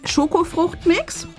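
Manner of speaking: usual speaking voice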